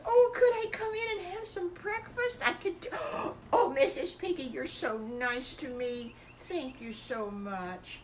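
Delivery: very high, then slowing down; shy wolf thanking Mrs Piggy for breakfast; grateful little wolf. Acted. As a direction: beg gently then melt with thanks. Sad wolf voiced, grateful.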